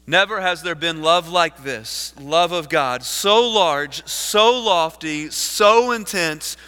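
loud, building oratory with weighted phrases; impassioned preaching. Acted. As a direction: proclaim it to the whole congregation. Preaches the quotation at full force.

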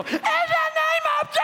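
very high, loud shouted command; a hammed-up exorcist's yell; deliverance shout. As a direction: cast it out at full cry. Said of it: "I landed right on this girl".

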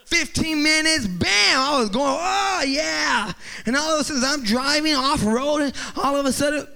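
high-pitched joyride excitement; hyped-up storytelling. Retold or imitated. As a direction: relive the thrill at near-shouting pitch. Re-enacting thrilled off-road drive.

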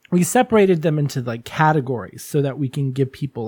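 ordinary talk

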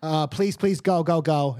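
impatient shoo. Acted. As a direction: hurry them out the door with a high voice. Shay shooing the visitors out.

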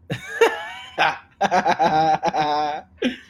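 long, loud, high laughing fit; hard laughter. Real, not acted. Friend mixed up bolillo and pan dulce, howling with laughter. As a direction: lose it laughing.

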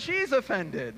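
normal talking voice

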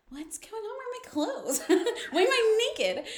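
high-pitched mock bewilderment, breaking into laughter; confused waking-up voice. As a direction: imitate someone waking up puzzled, high and giggly. Retold character waking up confused.

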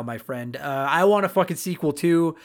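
usual speaking voice

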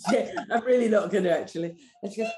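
ordinary talk, nothing marked

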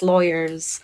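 normal talking voice

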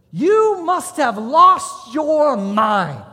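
slow, high, loud drill instructor bellow; drill instructor yell. Retold or imitated. Drill instructor yelling at a recruit in the chow hall, loud bellow. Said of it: "And he goes".

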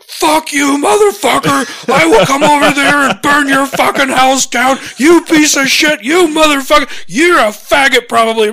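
high, loud furious yelling; mock furious tirade at the top of the voice; raging rant. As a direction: scream abuse like a maniac. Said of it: "raging fucking email".

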